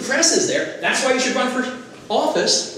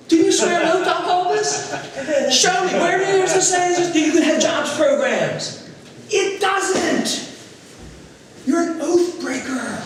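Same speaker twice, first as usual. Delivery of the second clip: challenging debate demand; 9 semitones higher, 4 dB louder, slower than usual. Retold or imitated. Confronting opponents at debate.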